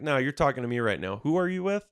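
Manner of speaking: retold challenge to a phone scammer; confronting the scammer. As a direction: press the caller hard. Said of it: "I was like".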